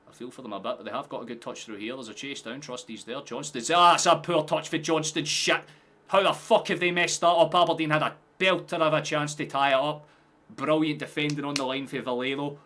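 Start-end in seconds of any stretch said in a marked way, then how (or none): 3.7-12.6 loud, high match-reaction yell; real excited shouting. Real, not acted. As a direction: shout in disbelief at the play. Live reaction to a missed chance.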